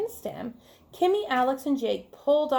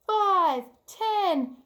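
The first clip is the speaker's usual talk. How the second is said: high cheering count by fives; 8 semitones higher, slower than usual. Acted. Fives club cheering as they count, high excited. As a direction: cheer each number, pitched up. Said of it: "they cheered".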